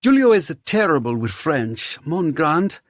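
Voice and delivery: high, loud, teasing host voice; flamboyant host. A narrator's reading. Theo teases about Giulio's French, flamboyant. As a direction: complain playfully in a lifted voice.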